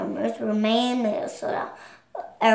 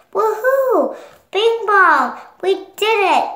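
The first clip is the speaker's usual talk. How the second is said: high and loud woohoo of triumph; thrilled shout of victory; excited cheer; 8 semitones higher, 5 dB louder than usual. Acted. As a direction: cheer it out, much higher and louder. Joy cheers as rocket flies.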